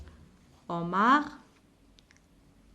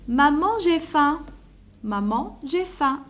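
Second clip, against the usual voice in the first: put-on higher, louder child's call; child calling mom; 4 semitones higher, 3 dB louder than usual. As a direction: call out to mom like a hungry kid. Child calls mother, hungry. (appel d'enfant affamé, plus aigu et plus fort)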